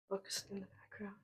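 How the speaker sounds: whispered mic test; breathy unvoiced whisper. Shown as a demonstration. Testing mic, whispered take.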